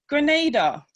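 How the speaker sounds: Grenada said loud and high, first A long. Shown as a demonstration. Guest teaching webinar viewers the island's name, long first A in Grenada. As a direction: stretch the first A in Grenada, punched out. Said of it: "The first A is a long vowel".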